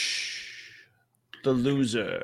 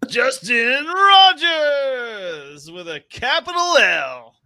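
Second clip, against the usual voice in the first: slow, high, drawn-out loser announcement; game show announcer; 10 semitones higher, 7 dB louder, much slower than usual. Acted. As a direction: stretch the name like a ring announcer. Mock-crowning the week's loser.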